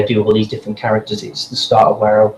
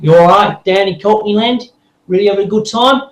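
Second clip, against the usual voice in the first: higher-pitched, chirpy Cockney accent; 8 semitones higher than usual. Shown as a demonstration. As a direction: put on a Cockney greeting accent. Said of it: "When you do a Cockney accent".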